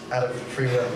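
ordinary talk, nothing marked

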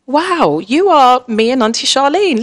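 high, loud delighted wow; amazed. Retold or imitated. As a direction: marvel at her. Amazed at daughter's stand.

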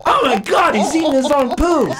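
high, loud mock horror; horrified shock. Girls waiting for the loo catch him, horrified outcry. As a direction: exclaim it like a scandalized onlooker.

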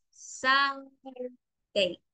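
Saturday drawn out very slowly, first vowel stretched; teacher models Saturday slowly for the class, stretching each sound; slow model word. Shown as a demonstration. Teacher to online class: Saturday, slow and stretched. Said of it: "En el caso de la vocal A en Saturday".

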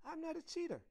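high, indignant denial; high-pitched insistent child. A narrator's reading. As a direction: protest like an accused little girl. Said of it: "insisted Heidi".

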